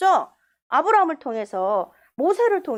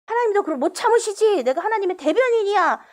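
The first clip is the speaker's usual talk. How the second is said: self-righteous boast; 7 semitones higher, 4 dB louder than usual. Retold or imitated. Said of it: "그렇게 얘기하면 안 돼요".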